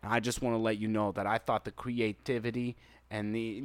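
low, earnest reviewer voice; hushed, serious mock-sincere appeal; Donkey. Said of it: "the serious donkey voice".

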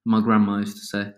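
normal talking voice

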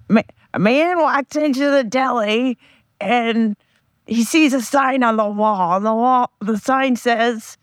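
a second-hand, hammed-up celebrity voice telling a deli joke; friend's Gilbert Gottfried impression. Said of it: "try to impersonate my friend telling the joke".

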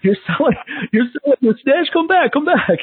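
hammed-up comic voice, laughing through it; Mike Epps impression. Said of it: "Mike Epps has a funny joke".

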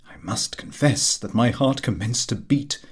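usual speaking voice

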